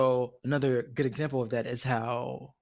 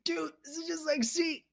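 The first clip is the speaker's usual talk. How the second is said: excited realization. Retold or imitated. Retells own excited reaction.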